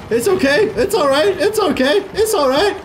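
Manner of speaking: high, quick reassurance on repeat; soothing a pet. Pretend. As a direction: hush a frightened animal in a high little voice. Soothing a distressed robot called Lassie.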